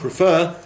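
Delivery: prefer, high and stressed on FER; second-syllable stress. Shown as a demonstration.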